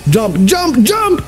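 climbing high urgent yell; frantic gamer callout; urgent command. Real, not acted. Urging teammate to jump.

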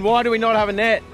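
ordinary talk, nothing marked

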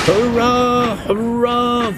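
high, loud triumphant cheer; roaring cheer. A narrator's reading. Runaway trucks cheering as they break free, loud high roar. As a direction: roar with glee as the rope snaps. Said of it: "they roared".

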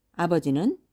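usual speaking voice

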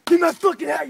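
loud snapped command; mock drill-sergeant bark; barked order. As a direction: snap the order at the recruit. Ordering subordinate around.

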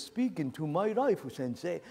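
put-on Japanese accent; Japanese guy. Condemned man inviting the sage's advice, Japanese accent. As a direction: do an eager Japanese pupil's accent. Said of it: "the Japanese guy says".

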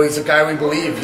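normal talking voice

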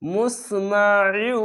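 মুসমাইউ slowly, with the merged sound held through the nose; ghunna recitation. Shown as a demonstration. Teacher demonstrating merging with ghunna, slow and held. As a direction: draw out মুসমাইউ, humming into the join. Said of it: "এখানে ঈদগামে বা গুন্না".

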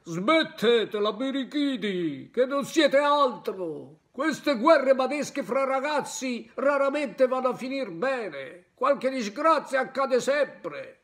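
slow, loud, croaking voice like a trombone with a cold; hoarse crab shouting. Acted. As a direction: scold the boys as an old crab with a head cold. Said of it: "gridò con una vociaccia di trombone infreddato".

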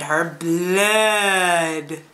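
drawn-out mock monster craving; creepy bloodthirsty fury. Reviewer telling how a fury wanted her blood, creepy drawl. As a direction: stretch it out like a hungry ghoul.

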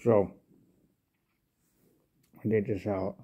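talking around tobacco pouch. Real, not acted. Seen 0: Lip bulges at start; pouch pulled out at 3.4, then held up.

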